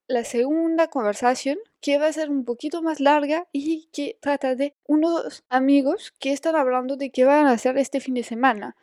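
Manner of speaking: usual speaking voice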